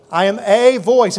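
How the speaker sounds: "a" stressed as a long "ay", slowly. Shown as a demonstration. Preacher showing it says "a" not "the", stressing "a". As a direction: punch "a" as "ay", not a weak "uh". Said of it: "A or the?".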